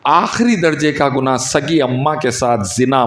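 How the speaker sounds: ordinary talk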